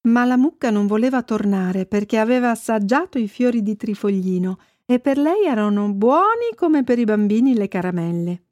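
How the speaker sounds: usual speaking voice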